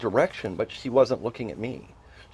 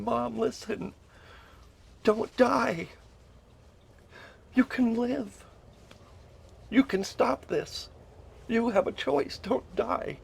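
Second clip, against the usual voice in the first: high, strained begging, held back in volume; desperate pleading; 9 semitones higher, 4 dB quieter, slower than usual. Retold or imitated. Son shaking his mom, desperate high pleading. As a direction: beg in a much higher voice without shouting.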